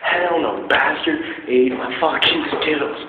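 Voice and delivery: high, loud, slow indignant rant; mock outrage. Real, not acted. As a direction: drag it out, outraged. Ranting about stolen candy.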